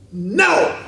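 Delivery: imitating a gleeful, emphatic toddler. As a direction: play a baby proudly declaring it. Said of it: "it says it emphatically".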